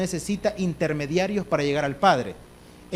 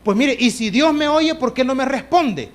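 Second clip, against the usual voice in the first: raised, louder mock complaint; challenging objection; 5 semitones higher, 5 dB louder than usual. Doubter asking why God stays silent, challenging. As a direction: voice a doubter's protest, pitched up. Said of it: "Claro que algunos dirán".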